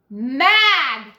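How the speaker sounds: "mad" punched loud and hard; angry emphasis. A narrator's reading. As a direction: bark out "mad" like a flash of temper. Narrator stresses her anger.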